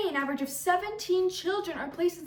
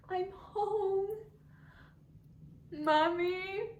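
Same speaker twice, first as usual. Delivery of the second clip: higher-pitched, hopeful call into the house; calling for parents; 5 semitones higher, 4 dB quieter, slower than usual. Acted. Daughter home from school calls for her parents, searching. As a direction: call out questioningly, voice lifted.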